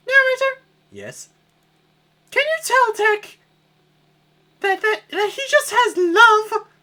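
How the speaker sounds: high falsetto princess complaining. Acted. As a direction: squeal like a spoiled princess. Peach voice.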